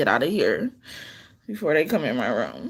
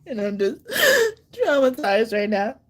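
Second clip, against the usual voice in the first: crying; 7 semitones higher than usual. Real, not acted. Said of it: "despite the fact that I'm crying".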